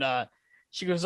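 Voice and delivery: ordinary delivery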